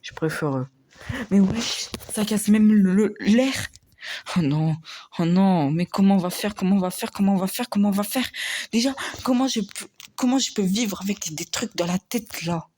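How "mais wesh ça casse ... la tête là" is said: panicked. Acted. Panics about surviving.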